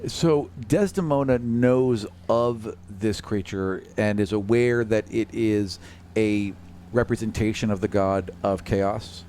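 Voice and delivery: ordinary delivery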